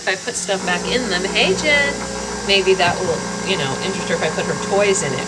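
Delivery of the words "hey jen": louder, higher call across the room; loud call to Jen. Real, not acted. Calling out to Jen.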